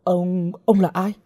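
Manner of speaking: high, stammered bewilderment; bewildered question. A narrator's reading. Captive girl to a strange man, bewildered stammer. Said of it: "ngơ ngác hỏi".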